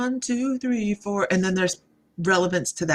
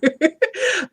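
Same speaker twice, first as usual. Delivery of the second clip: high laugh; 14 semitones higher, 5 dB louder than usual. Real, not acted. Laughing at the jackhammer noise.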